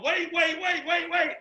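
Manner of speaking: high, loud, repeated calls; urgent calling. Retold or imitated. Retelling himself running after the car, urgent calling. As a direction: imitate calling after someone running away. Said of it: "like that, right?".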